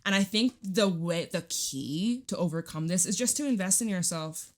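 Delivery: usual speaking voice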